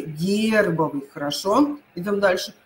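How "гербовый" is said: slow, low Гербовый with stressed «гер»; Гербовый with a long stressed Е. Shown as a demonstration. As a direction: draw out the first syllable of Гербовый. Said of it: "Ударение уйдёт на Е".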